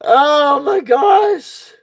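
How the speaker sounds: high, very slow and drawn out through laughter; real exhausted laughter; laughing. Real, not acted. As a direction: gasp it out between laughs. Worn out from laughing.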